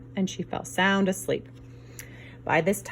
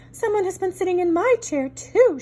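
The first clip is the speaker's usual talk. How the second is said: higher mama bear voice; a mother bear, a bit raised; mama bear complaining, higher; middle-sized voice; 10 semitones higher, 3 dB louder than usual. Acted. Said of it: "in her middle-sized voice".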